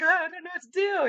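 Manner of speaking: very high, flustered inner cry; panicking. Retold or imitated. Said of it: "panicking in my head".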